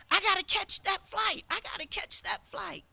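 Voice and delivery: a late customer begging the agent to make the flight; frantic customer. Retold or imitated. Rushed passenger at the counter, frantic and pleading.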